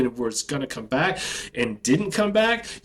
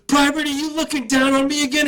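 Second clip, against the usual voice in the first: drill sergeant yell; 11 semitones higher than usual. Retold or imitated. Drill sergeant yelling at a recruit who looked down. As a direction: bark it like a drill instructor.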